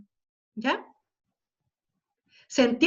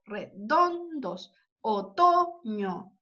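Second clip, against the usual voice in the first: "redondos, otoño" spoken very slowly, leaning on "don" and "to"; stressed syllables drawn out; 3 semitones lower, much slower than usual. Shown as a demonstration. Teacher slowly reading the rhyming words to the class, marking the stressed syllables. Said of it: "en la carga de voz se da".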